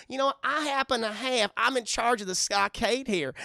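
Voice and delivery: high, loud, put-on prissy voice; acted haughty little official; Lil Gideon. Voices Gideon asserting his authority.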